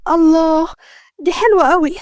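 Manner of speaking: acted delight at a surprise; delighted amazement. A narrator's reading. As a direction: marvel at a lovely gift, pitch lifted. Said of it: "كانت باصة باندهاش وبابتسامة".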